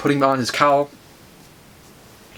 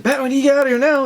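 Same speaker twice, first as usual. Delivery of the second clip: fast, higher-pitched urgent plea; frantic urging; 8 semitones higher, much faster than usual. Retold or imitated. Flash hurrying Batman out of here, frantic. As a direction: rush it out in mock panic.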